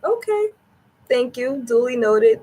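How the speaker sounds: mock thanks. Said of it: "And I was like".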